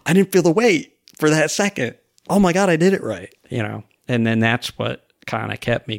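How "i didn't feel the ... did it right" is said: high-pitched, acted delight; retold amazement. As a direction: imitate thrilled disbelief, voice lifted. Retold amazement at a successful lift.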